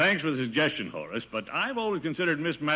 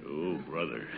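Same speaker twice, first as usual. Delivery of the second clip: low, soft, defeated groan; exasperated groan; 11 semitones lower, 9 dB quieter than usual. Acted. Boss groaning at the hopeless typist. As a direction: sigh it out in despair.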